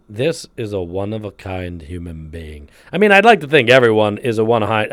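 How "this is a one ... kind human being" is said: much softer, slow awed aside; soft awed quote. Retold or imitated. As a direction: say it hushed in quiet wonder. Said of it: "you meet and you go".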